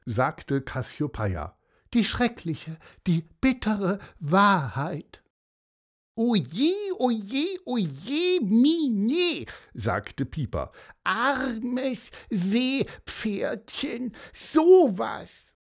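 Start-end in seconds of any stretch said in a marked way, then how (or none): 1.9-5.2 higher, mildly hammed-up woe; lightly melodramatic horse; Cassiopeia lamenting. A narrator's reading. Horse swearing its story is true, mildly tragic. 6.1-9.4 very high, singsong fretting; Pieper, dismayed. A narrator's reading. Pieper lamenting the horse's fate, high dismay. 11.0-15.5 high, very drawn-out sympathy; Pieper, pitying. A narrator's reading. Pieper feeling for the seahorse, high cooing pity.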